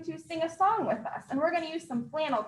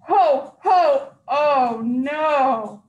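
alarmed Santa shout; 9 dB louder, much slower than usual. Acted. Santa's harness snaps mid-flight.

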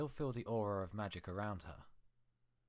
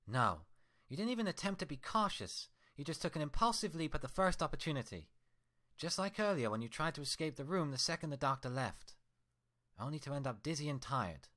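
higher, louder furious scolding; angry Twilight; 8 semitones higher, 4 dB louder than usual. Acted. Twilight scolding her mirror twin, furious and loud. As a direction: tear into her reflection with rage.

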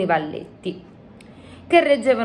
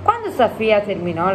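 ordinary delivery

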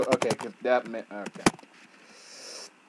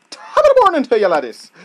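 high, loud mock Irish greeting; hammed-up Irish Jack greeting; Jack impression; 10 semitones higher, 12 dB louder, much faster than usual. Gamer doing his Jack impression, mock Irish. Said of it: "my best Jack impression".

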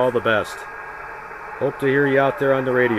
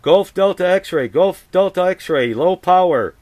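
radio call; 5 semitones higher than usual. Acted. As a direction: clear ham radio call voice. Ham radio call on air.

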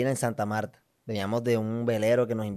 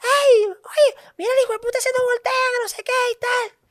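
very high, fast mock shout of an old woman; hammed-up drunk lady yelling after him. Drunk woman yelling insults behind them, high mock. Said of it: "empezó la vieja atrás".

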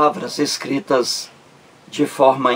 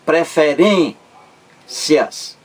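"Preferências" slow, leaning on "rên", pause before "cias"; stress on penultimate syllable; 2 semitones higher, much slower than usual. Shown as a demonstration. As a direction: hold "rên" in "Preferências", then a separate "cias". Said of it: "A sílaba mais forte é a penúltima". ("Preferências" devagar, destacando a penúltima sílaba "rên")

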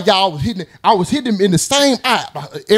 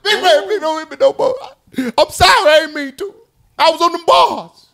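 mock begging; 8 semitones higher than usual. Mimics beaten man apologizing.

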